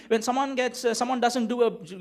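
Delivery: ordinary talk, nothing marked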